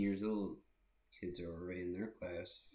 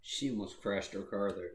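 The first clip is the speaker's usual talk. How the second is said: ordinary delivery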